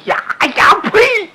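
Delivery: a sudden, high, furious roar of rage; opera-style angry battle cry. Acted. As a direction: spit it out like an enraged general. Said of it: "火了！".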